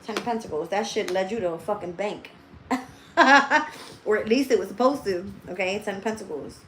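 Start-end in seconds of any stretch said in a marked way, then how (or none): 3.1-4.0 a burst of loud laughter, high and bright; laughing out loud at own joke; loud real laugh. Real, not acted. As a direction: break into a big real laugh.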